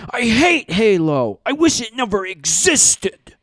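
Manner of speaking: put-on high, slow, whining man-child; whiny crybaby. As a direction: wail like a sulky kid who wants mommy. Cal whining about Halo.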